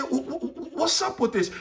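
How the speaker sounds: acted stammering disbelief; baffled stammer. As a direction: stumble on the first word, then blurt the question. Acted stammering dreamer questions the angel.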